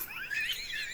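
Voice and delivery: sudden high-pitched cackle; amused squeal of a laugh. Real, not acted. Laughs at her wig joke.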